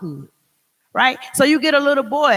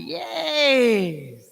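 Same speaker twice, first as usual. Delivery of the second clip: sassy yes; 4 dB quieter than usual. Retold or imitated. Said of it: "You gonna be like".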